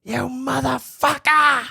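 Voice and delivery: put-on loud, high, slow Boston-accented cursing; mock Bostonian Irish woman. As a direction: curse like an irate Boston Irish mom. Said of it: "Bostonian Irish woman".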